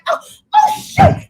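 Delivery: very high, slow, loud startled cry; startled yelp. Retold or imitated. Opening the trash can lid, shrieking at a rat. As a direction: jump back shrieking at the rat. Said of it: "I open the lid like".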